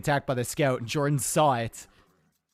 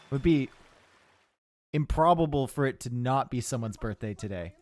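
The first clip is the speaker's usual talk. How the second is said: normal talking voice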